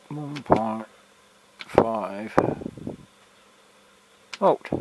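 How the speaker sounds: sniffing between words; quick sniff. Real, not acted. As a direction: talk with a sniffly cold nose. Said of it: "Sorry I keep sniffing".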